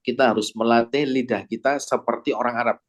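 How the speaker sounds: ordinary talk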